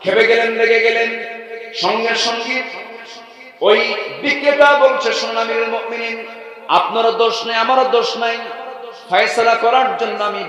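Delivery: high, loud declamatory sermon chant; ringing preacher's delivery; full-force preaching. Acted. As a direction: proclaim it to the whole gathering on a high held pitch.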